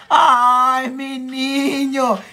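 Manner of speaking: tender gush. Real, not acted. Child sent a kiss.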